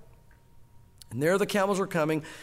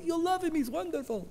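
a much higher, fond servant voice reassuring her; beaming servant; 10 semitones higher, 3 dB quieter than usual. Retold or imitated. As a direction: servant Eliezer, smiling, promises the bride she'll love Isaac: high, fond reassurance.

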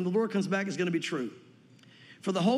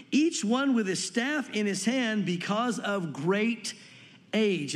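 "great" drawn out slow and weighted before "age"; stress on great; much slower than usual. Shown as a demonstration. Pastor reading Zechariah to his church, slowly stressing "great". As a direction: lean hard on "great", slowing right down. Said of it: "I wanna emphasize the word great there".

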